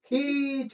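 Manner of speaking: खींच stretched on its vowel; drawn-out word. Shown as a demonstration. Teacher answering own question, खींच drawn out. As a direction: hold the vowel in खींच long. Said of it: "क्या करके ले जाना".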